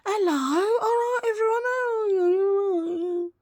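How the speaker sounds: hammed-up high sing-song hello; put-on high voice. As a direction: greet everyone in a silly high put-on voice. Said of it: "unless I'm talking like that".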